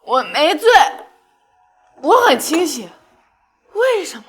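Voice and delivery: loud tipsy pleading; drunken outburst. Acted. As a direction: yell it drunk and hurt. Drunk girl yells at prince.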